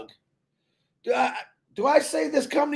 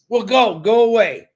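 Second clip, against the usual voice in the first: loud, punched-out send-off; blunt dismissal; 6 dB louder than usual. Real, not acted. Retort to people tired of waiting, blunt dismissal. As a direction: send them off loudly and bluntly.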